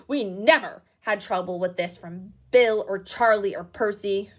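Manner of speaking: loud furious scolding; raging mother. Acted. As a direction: tear into your sons at full volume. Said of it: "her rage broke over them".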